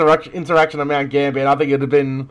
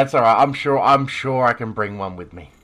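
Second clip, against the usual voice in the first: ordinary talk, nothing marked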